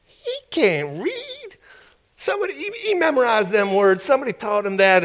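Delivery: very high, hushed conspirators' voice, easing down; mock whispering. Officials whispering amongst themselves at the file cabinet, mock whispering. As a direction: imitate incredulous men conferring in squeaky undertones. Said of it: "they started whispering amongst themselves".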